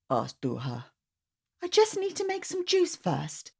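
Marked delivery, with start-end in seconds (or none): 1.6-3.5 auntie voice. Acted. Auntie replying.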